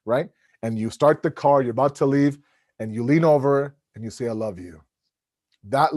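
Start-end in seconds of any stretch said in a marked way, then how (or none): 4.3-4.8 low, hushed declaration of love; tender. Acted. Husband leaning over to wife in car, tender. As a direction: murmur it softly and intimately.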